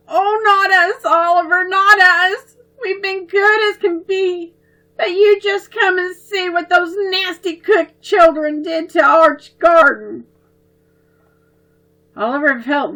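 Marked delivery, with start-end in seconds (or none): 0.1-10.2 put-on high, loud, teary child protest; upset sobbing. A narrator's reading. Sisters crying to their brother about the garden, high and teary. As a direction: wail it out, close to crying. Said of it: "two sobbing sisters".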